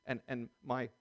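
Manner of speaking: ordinary delivery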